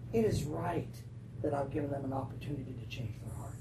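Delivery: soft, low voice of God; gentle reasoning. Acted. God trying to reconcile Jonah, soft and patient. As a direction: reason with him very quietly, fading toward a whisper.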